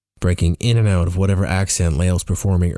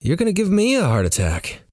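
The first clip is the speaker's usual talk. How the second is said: high-pitched playful scolding; mock-exasperated chiding, pitched up; 11 semitones higher than usual. A narrator's reading. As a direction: throw the blame back at them. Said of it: "I chide her".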